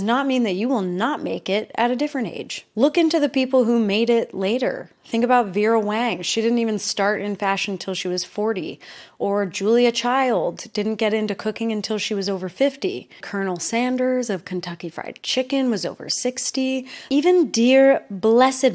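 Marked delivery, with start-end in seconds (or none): none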